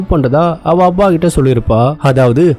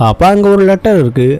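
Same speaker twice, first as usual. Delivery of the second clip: usual speaking voice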